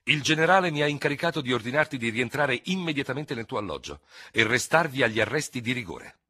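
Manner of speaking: ordine vivace, più acuto e forte; vivacità decisa. A narrator's reading. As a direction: riferisci l'ordine con energia, a voce alta. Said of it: "parlò con una certa vivacità". (con una certa vivacità)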